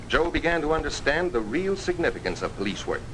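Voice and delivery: usual speaking voice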